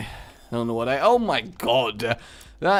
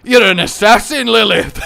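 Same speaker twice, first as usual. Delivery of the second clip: Hagrid impression; 8 semitones higher, 11 dB louder than usual. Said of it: "Hagrid recruited her".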